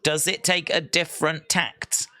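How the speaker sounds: usual speaking voice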